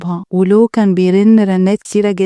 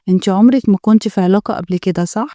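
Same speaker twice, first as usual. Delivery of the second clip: ordinary delivery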